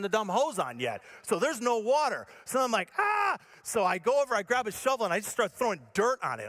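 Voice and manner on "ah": high, open "ah" of panic; mock panicked yell. Storyteller finds the hose dry, high mock panicked yell. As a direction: yelp as if out of options.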